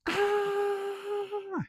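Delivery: imitated shrill scream, held long; thin, very high mock scream without extra volume; high-pitched scream. Reviewer imitates the movie girl's constant screaming, high-pitched mock scream. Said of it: "constant high-pitched screaming".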